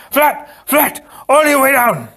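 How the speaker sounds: high, loud shouted orders; urgent cry. A narrator's reading. Inigo slamming Fezzik to the floor, urgent shouting. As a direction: cry out each command forcefully.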